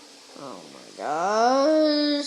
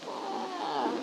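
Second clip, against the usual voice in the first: ordinary delivery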